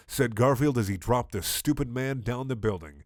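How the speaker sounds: usual speaking voice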